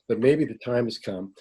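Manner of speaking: ordinary talk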